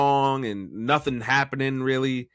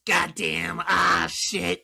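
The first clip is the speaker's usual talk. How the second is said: higher pained groan; Rey Mysterio pained voice; 6 semitones higher, much slower than usual. Retold or imitated. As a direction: groan like you're being squeezed hard. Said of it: "make fun of him with that voice".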